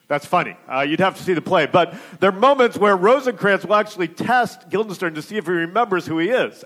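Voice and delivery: ordinary talk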